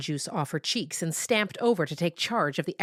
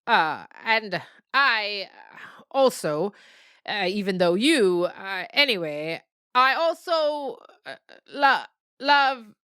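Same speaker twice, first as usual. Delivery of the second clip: awkward reluctant; 4 semitones higher, 4 dB louder, slower than usual. Acted. Reluctant parent saying love.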